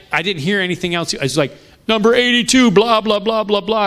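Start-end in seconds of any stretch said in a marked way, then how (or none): 1.9-3.8 high, louder blah-blah imitation of a lecturer; mock droning list. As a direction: rattle on as the speaker nobody is hearing. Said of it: "he was just talking".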